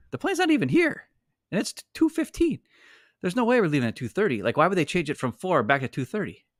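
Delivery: squeaky high, disbelieving rant at the gate; mock-outraged complaint that starts very high and settles; exasperated mock reply. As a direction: jump way up in pitch, incredulous. Retelling their exasperated reply.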